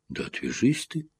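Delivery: a high, strained cry of despair; high, desperate outcry. A narrator's reading. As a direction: shove him off in despair. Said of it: "в отчаянии крикнул Джонни".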